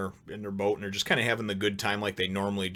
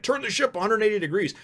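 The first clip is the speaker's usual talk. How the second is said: high, quick mock alarm; fast, high put-on command; imitated urgent order; 12 semitones higher, 3 dB louder than usual. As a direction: act out calling to turn the ship, pitched up. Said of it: "He says, you know".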